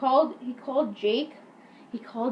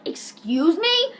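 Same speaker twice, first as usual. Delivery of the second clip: higher, slow rising question; mock outrage; 7 semitones higher, 4 dB louder, much slower than usual. Offended principal challenges Eric, slow mock outrage. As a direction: stretch it out in indignation.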